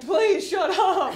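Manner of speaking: pleading. Acted. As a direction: imitate a squeamish listener begging her to stop. Squeamish listeners begging, acted.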